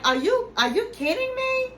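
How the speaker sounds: high mock-incredulous imitation of Gino. Gino balks at being uninvited, mock disbelief. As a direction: squeak it out in disbelief, imitating him. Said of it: "And he was like".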